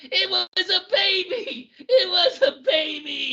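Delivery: high, slow hammed-up sob of a broken man; mock crying breakdown. Imitating Alan Alda's crazed Hawkeye breakdown, hammed-up sobbing. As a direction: wail it like a melodramatic actor falling apart. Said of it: "And he just starts crying".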